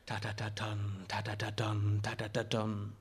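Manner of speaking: low, soft drumbeat syllables; soft, steady ta-ta-ta-dun beat; rhythmic tapping imitation. A narrator's reading. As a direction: tap out a tattoo with the voice, low and even. Tattoo tapped against his thigh.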